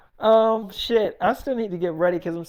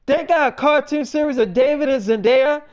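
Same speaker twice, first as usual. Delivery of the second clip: shocked question; 6 semitones higher, 4 dB louder than usual. Retold or imitated. Discovering cartoon about them.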